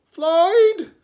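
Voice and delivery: loud, high shout of the name. Acted. A neighbour yells up at Floyd in the tree, loud. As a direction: yell it from across the street.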